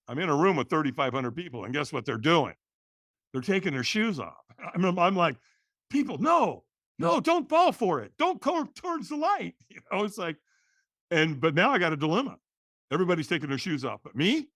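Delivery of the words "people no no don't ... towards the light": high, hammed-up warning to the crowd; mock high plea to the shoeless crowd. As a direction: plead with everyone not to fall for it.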